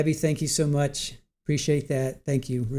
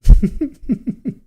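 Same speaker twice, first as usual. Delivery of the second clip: loud high laugh; 10 semitones higher, 11 dB louder than usual. Real, not acted. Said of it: "I'm laughing so hard".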